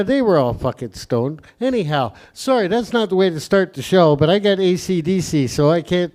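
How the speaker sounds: ordinary delivery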